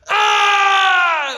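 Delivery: huge, high warrior scream; battle scream. Shown as a demonstration. Teacher showing a Cherokee warrior's battle scream. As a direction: let out a full battle cry.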